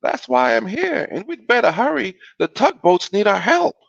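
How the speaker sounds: high-pitched, animated mother voice; storybook mama. A narrator's reading. Mother reassures child in story.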